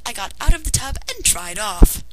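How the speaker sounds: plain reading voice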